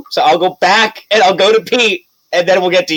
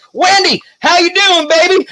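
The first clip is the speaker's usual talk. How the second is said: much higher, bouncy greeting voice; high-pitched mayor; 3 dB louder than usual. Acted. Mayor fussing over Wendlyn, high and sweet.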